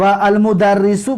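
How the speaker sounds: المدرسو said slowly syllable by syllable, kasra under the doubled r; slow teaching demo of المدرسو; careful Arabic pronunciation. Shown as a demonstration. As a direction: spell out المدرسو slowly as al-mudarrisu. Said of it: "چیزی هست برای شاید بسیار مردم ندونن".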